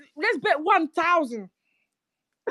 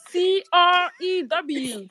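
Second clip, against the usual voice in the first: spelling it out; slower than usual. Shown as a demonstration. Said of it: "I said Zeldy crew".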